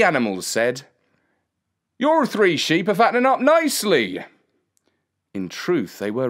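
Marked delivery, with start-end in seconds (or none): 2.0-4.2 higher-pitched trader's voice; sly dog-owner. A narrator's reading. Man flatters boy about his sheep, higher character voice. Master of the dogs speaks.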